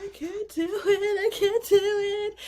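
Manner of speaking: high, tearful, slow plea reenacted; a retold sob, voice high and breaking; imitated sobbing. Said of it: "And I just start sobbing".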